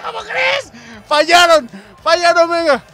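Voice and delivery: very high-pitched yelling; incredulous excited shout. Real, not acted. Commentator seeing a penalty missed, incredulous high shouting. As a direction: shriek in disbelief, then shout the news.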